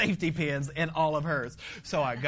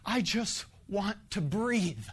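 desperate tired plea; pleading prayer; 4 semitones higher than usual. Retold or imitated. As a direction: plead with God for breathing room. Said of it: "this was the terminology that I used".

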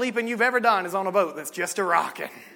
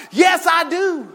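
shouting it out, high and loud; loud preaching; 7 semitones higher, 7 dB louder than usual. Real, not acted. The preacher telling the church why he's loud, shouting. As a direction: preach at full force. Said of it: "I've got to be loud about it".

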